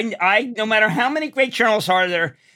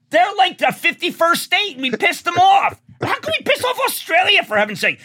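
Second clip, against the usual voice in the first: rising indignation at pissing off allies; exasperated rant; 10 semitones higher than usual. Real, not acted. To the interviewer, venting about angering Australia, high and exasperated.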